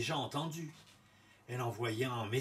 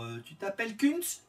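higher, hopeful guess; queen's voice; 7 semitones higher than usual. A narrator's reading. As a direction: ask the name hopefully, higher. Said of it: "Elle répondit d'abord".

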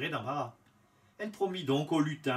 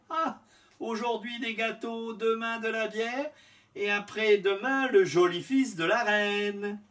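higher, louder gleeful chant; gleeful shout; 7 semitones higher, 5 dB louder than usual. A narrator's reading. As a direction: crow it out, hopping with glee. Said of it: "en criant".

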